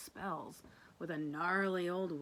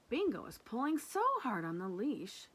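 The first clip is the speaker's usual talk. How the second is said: usual speaking voice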